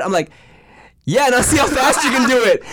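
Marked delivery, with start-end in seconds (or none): 1.0-2.6 high, loud, through laughter; giggling mock challenge; laughing tease. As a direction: egg her on while cracking up. Teasing classmate, laughing.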